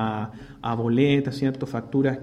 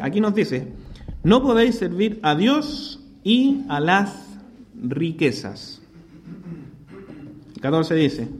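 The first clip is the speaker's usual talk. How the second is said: scripture reading; 4 semitones higher than usual. Acted. Reads scripture aloud.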